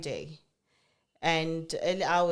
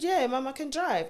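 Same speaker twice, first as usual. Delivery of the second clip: put-on casual teen son; 7 semitones higher than usual. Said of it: "He said".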